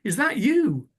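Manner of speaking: high, questioning Scottish lilt on a short query; imitated Scottish lassie's question; Scottish waitress. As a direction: quote the Scottish waitress, pitched well up. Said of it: "she looked at me and said".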